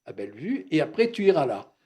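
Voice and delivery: mapping out my future like a proud father; father laying out the path. Retold or imitated. Father tells his son which schools he'll attend, loud and firm. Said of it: "Et il me dit".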